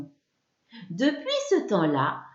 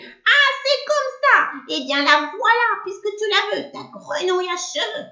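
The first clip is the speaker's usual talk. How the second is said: witch howling; 11 semitones higher, 5 dB louder than usual. A narrator's reading. Witch howling as she gives up the frog. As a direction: scream it out at full force. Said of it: "la sorcière se met à hurler".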